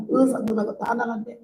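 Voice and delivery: ordinary talk, nothing marked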